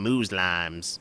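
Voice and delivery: slow, drawn-out "Muslims" as "MOOZ-lahms"; mock mispronunciation. Host mocking the speaker in the clip, slow "MOOZ-lahms". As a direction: stretch "Muslims" with a long oo and a long last vowel.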